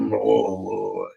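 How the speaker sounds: low whoa sliding back up; vocal warm up. Shown as a demonstration. Warming up before the movie voice, low whoa. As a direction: loosen the voice up with low whoas. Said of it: "vocal exercise warm up".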